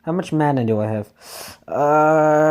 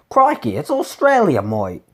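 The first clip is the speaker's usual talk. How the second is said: high-pitched mock Australian; hammed-up Australian twang; terrible Australian accent; 4 semitones higher than usual. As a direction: put on a bad, exaggerated Australian accent. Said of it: "doing a terrible Australian accent".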